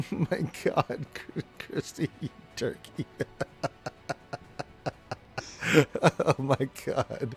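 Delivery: wheezing, voiceless laughter swallowing the words; painful laughter. Real, not acted. Game master teasing a player called turkey, breathless wheezing laughter. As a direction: laugh till only breath comes out. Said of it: "the pain is real".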